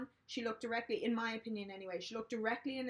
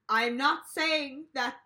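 slow, much louder, clipped-off denial; aggressive; 3 semitones higher, 9 dB louder, much slower than usual. Retold or imitated. Nominee denying to the panel, slow aggressive mock insistence. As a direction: hammer each word defensively. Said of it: "real aggressive".

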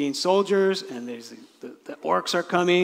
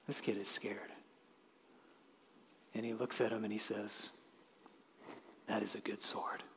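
gentle king; 6 semitones lower, 16 dB quieter than usual. Acted. Aragorn reassures scared boy.

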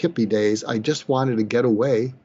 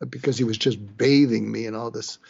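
ordinary talk

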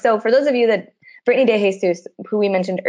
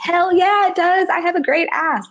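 much higher, cocky hammed-up brag; sassy bold boast; 11 semitones higher than usual. Retelling friend's confident reply.

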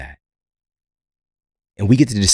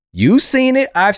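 much higher, louder; emphatic high; 5 dB louder than usual. Real, not acted. Emphatic appeal.

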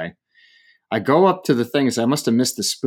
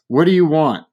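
mildly mimicked brusque demand, louder; confrontational waiter; 5 semitones higher, 5 dB louder than usual. Waiter to a complaining diner, mock confrontational. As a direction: challenge the customer, raised pitch. Angry staff sends waiter over.

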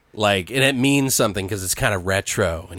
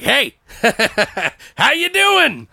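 high, cheery voice of a mad Santa; killer Santa; 4 dB louder, slower than usual. Retold or imitated. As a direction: greet the victim like a giddy lunatic. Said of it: "the Santa's like".

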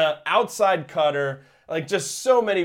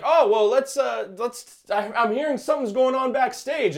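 announcer impression; 8 semitones higher than usual. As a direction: play the flustered commentator. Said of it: "And Don's like".

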